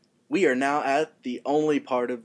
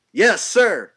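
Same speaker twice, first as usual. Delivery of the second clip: loud, higher mock chorus of soldiers; soldiers' reply; 8 semitones higher, 11 dB louder than usual. Recruits answering their lieutenant with a loud shout. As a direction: shout back like eager recruits. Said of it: "Brad Pitt's introduction scene".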